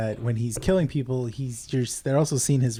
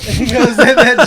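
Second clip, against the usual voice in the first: laughing; 10 semitones higher, 12 dB louder than usual. Real, not acted. Laughing while retelling.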